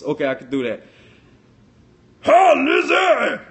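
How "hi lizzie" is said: high, loud, drawn-out silly greeting; over-the-top comic holler; funny voice. Shown as a demonstration. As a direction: squawk it in a goofy voice. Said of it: "but in a funny voice?".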